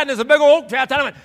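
normal talking voice

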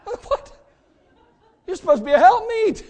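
high, loud laughing protest; hammed-up betrayed husband; mock outrage.

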